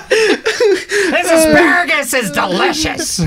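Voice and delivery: very high, slow, laughing squawk; Gilbert Gottfried impression. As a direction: screech it through laughter. Gilbert Gottfried at Tom's dinner.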